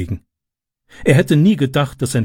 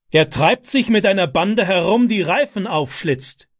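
shouting; 7 semitones higher than usual. A narrator's reading. Father yells at his frightened wife about the boy, angry. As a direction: fling the news at her angrily. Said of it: "schrie er seine erschrockene Frau an".

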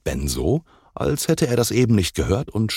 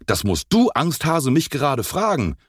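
higher-pitched indignant comeback; mildly angry retort; 8 semitones higher than usual. A narrator's reading. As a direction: snap back with a touch of anger. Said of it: "rief Enzo wütend".

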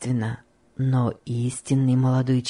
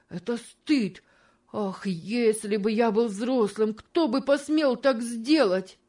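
high, pained cry of shame; a boy's outcry choked with sobs; crying out, holding back sobs; 7 semitones higher than usual. A narrator's reading. George cries out at Tom's sale, choking back sobs. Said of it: "кричал он со сдержанным рыданием".

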